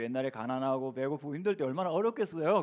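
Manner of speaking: usual speaking voice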